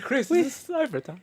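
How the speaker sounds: high, laughing falsetto. Retold or imitated. Cohost echoing the bit, laughing high falsetto. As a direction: try the line up high through laughter. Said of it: "It's like".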